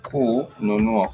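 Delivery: ninataka kununua said slowly, each syllable clear; slow teaching pronunciation; slow, careful. Shown as a demonstration. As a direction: stretch out ninataka kununua for learners. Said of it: "which is".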